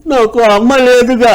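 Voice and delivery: put-on high, slow child voice; little boy. Lonely schoolboy tells the stranger, in a high child voice. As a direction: answer like a small sad boy. Small boy answers the narrator.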